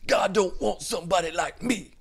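high, strained mock-dramatic voice; imitated anguished confession. Man who doesn't think he's good enough, high mock anguish. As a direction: overplay the self-pity in a high voice.

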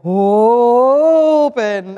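a long, loud, drawn-out open. Retold or imitated. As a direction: push open out with full force through a contraction. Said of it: "I had to really go".

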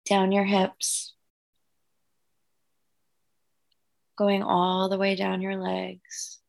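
calm guided breathing voice. Acted. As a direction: gently lead the breathing. Leads guided meditation visualization.

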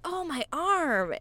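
slow, high whimper about his arm; hurt little boy. Retold or imitated. Boy complaining to dad after the punch, slow and high. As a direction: whine it out like a punched kid. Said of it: "he starts grabbing his arm".

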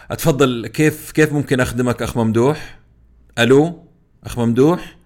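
loud, pushy call-center agent; impatient customer service voice. Retold or imitated. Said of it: "مثال".

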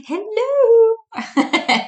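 put-on high, laughing old-lady hello; Mrs Doubtfire. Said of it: "like Missus Doubtfire".

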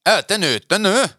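hammed-up impatience, pitch leaping up; irritated straining man. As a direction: imitate an annoyed man pushing hard. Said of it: "man kan mærke, han er irriteret".

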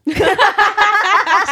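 high, loud laughing fit; loud burst of laughter. Real, not acted. A guest cracks up at the phrase, loud high laughter. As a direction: cracks up loudly, real.

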